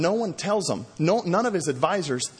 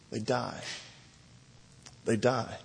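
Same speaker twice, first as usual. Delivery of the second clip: grave; 3 semitones lower, 7 dB quieter than usual. Real, not acted. Grave sermon point.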